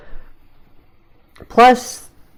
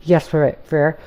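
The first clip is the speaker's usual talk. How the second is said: plain reading voice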